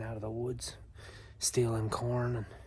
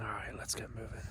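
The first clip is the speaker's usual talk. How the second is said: whispering; 7 dB quieter than usual. Real, not acted. Whispering before moving on.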